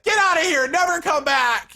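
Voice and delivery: very high, loud mock scream; outraged girlfriend. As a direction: shriek them out the door as the furious ex. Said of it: "goes into this whole outrage".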